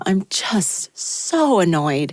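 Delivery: ordinary talk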